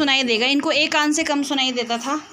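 loud and high so a deaf ear catches it. Real, not acted. To the hard-of-hearing uncle, loud and high. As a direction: call out clearly to someone hard of hearing. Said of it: "ज़ोर ज़ोर से चिल्ला चिल्ला के बोलना पड़ता है".

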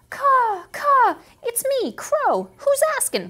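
high, loud cawing crow. Acted. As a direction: play a cocky crow. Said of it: "Crow responded".

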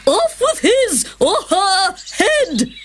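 high, slow imperious bellow; the Queen stamping round the croquet ground, shouting. A narrator's reading. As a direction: stamp about and order the beheadings.

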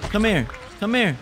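repeated high calls to a target; high-pitched calling. Real, not acted. Player chasing a zombie, high-pitched calling. Calls after enemy in game.

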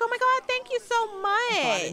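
high-pitched acted gush; gushing thanks. Girlfriend thanking his mom for the compliment, high gushing.

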